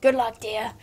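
lightly voiced granny; a hint of old granny in the farewell. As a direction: send them off as a mild granny. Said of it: "I can do my granny voice".

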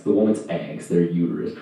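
ordinary talk

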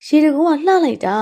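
high, thrilled exclamation; delighted admiration. Acted. Girl admiring the waterfall, delighted. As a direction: gush like an amazed girl.

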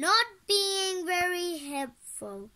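high, drawn-out scolding; quoting Mom. A narrator's reading. Repeating Mom's words to Alec, slow scolding. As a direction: stretch it slowly, loud and reproachful. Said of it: "as Mom said".

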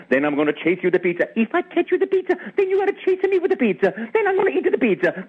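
very high, bubbly Italian plumber; Mario voice. Retold or imitated. Auditioning actor improvising as a cheerful, very high Italian plumber. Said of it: "in the voice that you hear today".

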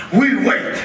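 loud. Acted. Guard repeats loudly.

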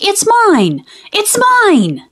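high, whiny "it's mine" twice; possessive kid. Acted. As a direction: clutch it like a selfish little boy. Mike refuses to share his pie.

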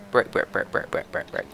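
rapid whispered brake chant; whispering brake, brake to himself; whispered urging. Real, not acted. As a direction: hiss it under your breath.

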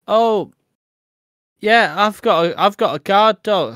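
high, loud keen volunteer; mocking eager helper. Host mocks useless volunteers, high and loud. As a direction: pitch it up like a proud dog owner. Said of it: "And you're like".

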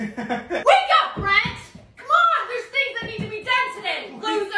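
high, pushy nagging holler; hammed-up high-pitched wake-up; rowdy wake-up call. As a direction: roust a sleeper, high and insistent. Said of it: "different reactions I'd have if I walked in".

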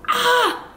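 short, very high, loud yelp; high nervous yelp; nervous squeal. Real, not acted. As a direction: squeal to psych yourself up. Nervous before the shot.

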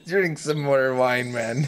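laughing. Real, not acted. Laughing while voicing drinker.

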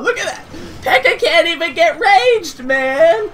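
high, laughing exclaim; laughing and yelling it out. Real, not acted. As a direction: crack up at the opponent's blunder. Laughing at failed rage.

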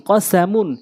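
short vowels, syllables run together; quick reading. Shown as a demonstration. Teacher showing how it must be read, quickly. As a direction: read it briskly as one word. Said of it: "দ্রুতটা উচ্চারণ করতে হবে".

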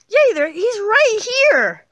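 high, loud, drawn-out protest; squealing insistence to a doubter; frantic insistence. Acted. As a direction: shout it desperately, voice way up. Insists the invisible friend is there.

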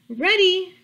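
high, loud little pig. Acted. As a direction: chirp it out like a proud piglet. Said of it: "The little pig said".

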